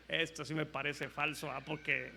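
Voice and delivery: lightly voiced suspicious king, under his voice; doubting king. Other king doubts the prophets, low doubtful aside. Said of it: "como que el otro rey dijo".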